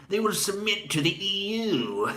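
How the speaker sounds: high, gloating British villain, then evil laugh; hammed-up haughty British voice with villainous cackle; haughty British tyrant. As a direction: proclaim it grandly as a British tyrant. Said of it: "That's my haughty British tyrant character".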